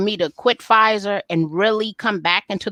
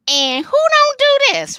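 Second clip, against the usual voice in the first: very high, squealing delight; excited squeal; 5 dB louder, slower than usual. Real, not acted. Student squealing over the fine men on campus. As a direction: squeal it like you can't believe your luck.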